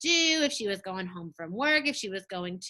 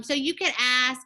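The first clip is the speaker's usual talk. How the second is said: ordinary talk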